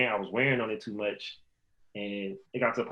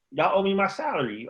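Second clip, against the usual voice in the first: higher, indignant demand; retold protest; 9 semitones higher, 3 dB louder than usual. Team withheld his month's pay, indignant demand. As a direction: demand what he's owed, fired up.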